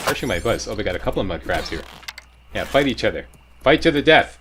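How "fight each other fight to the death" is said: loud, high egging-on shout; mock battle command. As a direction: goad them into fighting, loudly. Egging on fighting mud crabs.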